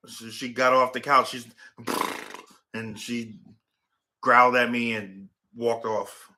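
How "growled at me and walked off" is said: ordinary talk, nothing marked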